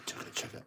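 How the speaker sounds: whispered chugga chugga train sound; breathy choo-train chug for kids; train chugging. Shown as a demonstration. Reading to kids, imitating the engine chugging softly. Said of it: "he went chugga".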